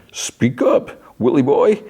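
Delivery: high-pitched clown taunt; Pennywise taunt. Retold or imitated. Clown goads Willie boy to answer, high taunting voice. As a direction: needle him in a very high, teasing voice. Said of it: "And Pennywise is like".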